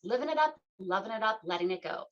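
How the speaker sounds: a bright jingle-like slogan read; radio tagline. Acted. Host delivering the show's slogan before the break.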